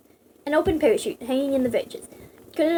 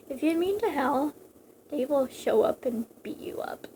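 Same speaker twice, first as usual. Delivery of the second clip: softer, whispered warning; mock whisper threat; 5 dB quieter than usual. Warning anyone mean to Hal, whispered. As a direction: hush it like a secret threat.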